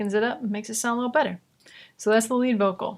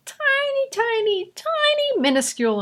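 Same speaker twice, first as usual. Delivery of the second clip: high squeaky tiny. Real, not acted. Emphatic repetition of tiny.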